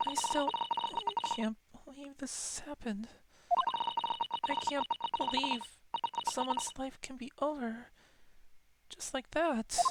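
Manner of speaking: put-on high, teary voice of a grieving young woman; high, trembling with grief; near tears. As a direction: read it as if about to cry. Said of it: "I'm almost crying as I read those words".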